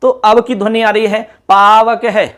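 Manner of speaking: normal talking voice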